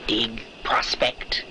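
slow hushed whisper. Acted. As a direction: whisper it slowly aside. Explaining the word in an aside.

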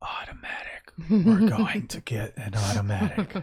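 mock hypnotic whisper; hushed and teasing, planting an idea; playful whisper. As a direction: whisper it as a subliminal message. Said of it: "he just whispers into my ear".

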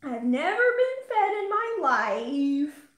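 slow, loud, high complaining cat; yelling cat voice. Retold or imitated. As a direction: voice the hungry pet yelling for food. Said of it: "we apply words to his yelling".

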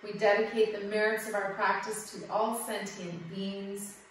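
closing dedication. Acted. Yoga teacher ends the class with a soft prayerful dedication. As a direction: offer the merits quietly, like a blessing.

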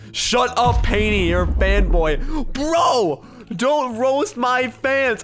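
loud, high scolding with drawn-out words; indignant shout. Real, not acted. Gamer defending fans from a troll, indignant shout.

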